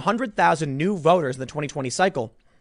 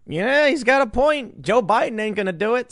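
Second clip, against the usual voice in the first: voter agreeing; 6 semitones higher than usual. Retold or imitated. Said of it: "they're gonna be like".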